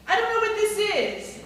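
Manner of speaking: high, loud exasperated protest; baffled Larry David. Acted. Frustrated charades player, baffled by the clue. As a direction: throw up your hands in confusion.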